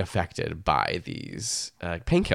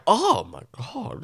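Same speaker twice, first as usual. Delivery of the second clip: higher, drawn-out imitated gasp of disapproval; mock horrified crowd; 8 semitones higher, 3 dB quieter, slower than usual. Deli crowd reacting to the American, mock horror. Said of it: "Everyone was like".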